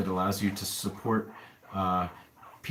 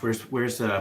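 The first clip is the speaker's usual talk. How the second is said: ordinary talk, nothing marked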